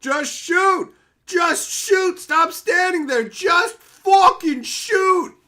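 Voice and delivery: high, full-force yell at the screen; frustrated yelling. Retold or imitated. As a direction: scream orders at a useless anime hero. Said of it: "I was yelling".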